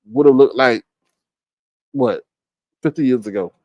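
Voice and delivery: usual speaking voice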